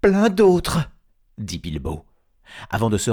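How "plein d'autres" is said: high, hesitant hobbit voice; frightened hobbit. Acted. Bilbo answering the threatening troll, frightened blurt.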